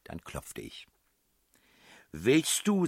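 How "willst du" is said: high, loud indignant challenge; princess voice. A narrator's reading. Princess to the man knocking at her door, indignant. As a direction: challenge the intruder sharply in a high voice. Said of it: "sagte die Stimme der Prinzessin".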